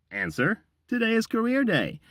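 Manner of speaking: high, bright game-show reveal; excited announcer. Acted. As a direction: announce it like a big prize. Hyped announcement.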